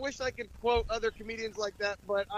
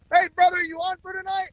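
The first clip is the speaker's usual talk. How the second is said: chummy hail; 9 semitones higher, 8 dB louder than usual. Retold or imitated. Voicing a cheery text to co-host.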